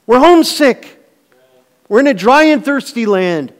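loud, high, driving sermon voice; a pastor pressing the point home to the church; full-force preaching. Acted. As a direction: preach it out with rising fervor.

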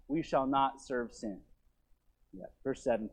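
ordinary delivery

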